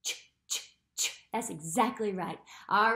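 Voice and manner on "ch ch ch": ch, ch, ch as unvoiced puffs; demonstrating ch for children; ch sound. Shown as a demonstration. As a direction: model the ch sound three times. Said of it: "Make the C H sound one more time".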